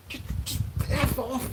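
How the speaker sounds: exasperated. Real, not acted. Fed up with blower noise.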